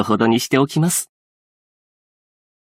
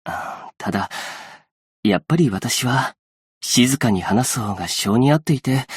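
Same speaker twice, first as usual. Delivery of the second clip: normal talking voice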